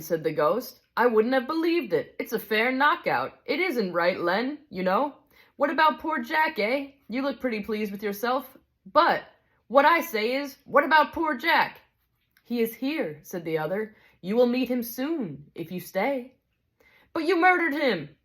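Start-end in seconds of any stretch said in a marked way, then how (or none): 1.0-11.8 higher, aggrieved working-man's complaint; indignant big ghost. Acted. Big ghost accuses the bright spirit over poor Jack, indignant. 12.4-16.4 a touch higher, calm and kindly; gentle bright spirit. Acted. Bright spirit calmly answers the big ghost, kindly. 17.1-18.2 higher, aggrieved working-man's complaint; indignant big ghost. Acted. Big ghost accuses the bright spirit over poor Jack, indignant.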